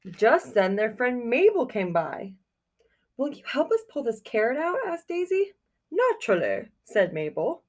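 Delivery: put-on reading a picture book aloud to children; storybook reading. A narrator's reading. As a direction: tell it like storytime for kids.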